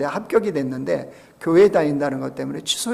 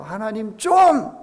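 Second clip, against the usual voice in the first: slow, drawn-out Gyeongsang plea; a hint of Gyeongsang pleading, drawn slow; Gyeongsang dialect plea; 5 semitones higher, 4 dB louder, slower than usual. Preacher voicing a Gyeongsang believer's plea, slow and drawn out. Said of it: "이럴 때 경상도 말로요".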